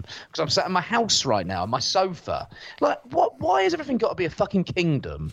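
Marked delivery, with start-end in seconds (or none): 2.8-5.3 exasperated. Retold or imitated. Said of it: "ranting at the kingdom talk".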